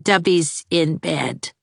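put-on slow, flat old woman; slow dull old housekeeper; stolid. A narrator's reading. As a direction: state it without interest.

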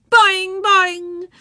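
high, loud springy boings; gleeful playground twang; teasing yells. A narrator's reading. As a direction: chant it like taunting children. Other children join the teasing yell.

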